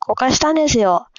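ordinary delivery

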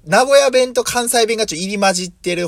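ordinary talk, nothing marked

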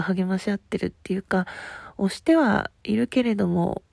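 ordinary delivery

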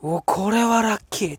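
mock glee. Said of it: "と".

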